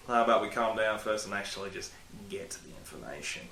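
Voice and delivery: low, measured mock scold to himself; scolding self voice. Vlogger telling himself to calm down, lightly stern. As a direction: talk yourself down like a stern parent. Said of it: "Why am I talking like that?".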